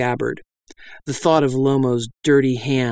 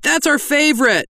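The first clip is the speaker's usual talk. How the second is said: heckling bandit; 13 semitones higher than usual. Acted.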